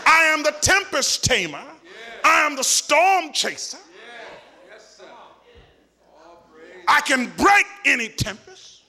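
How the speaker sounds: high, driving declarations building in a rolling list; full-force preaching. Acted. Preacher voicing Jesus' claims to the church, full-force preaching. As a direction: proclaim each title with rising force.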